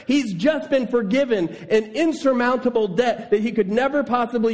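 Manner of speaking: high, incredulous indignant rant; outraged disbelief. Retold or imitated. Preacher voicing the congregation's outrage at the servant, high indignant disbelief. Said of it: "Where you go".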